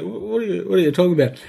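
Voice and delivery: puzzled mom. Acted. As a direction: lightly imitate a baffled mother. Mom's puzzled reply, acted out.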